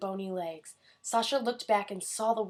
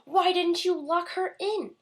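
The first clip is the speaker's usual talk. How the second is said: high, loud and slow witch; shrieking witch; 9 semitones higher, 8 dB louder than usual. A narrator's reading. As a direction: shriek in rage. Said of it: "she screamed".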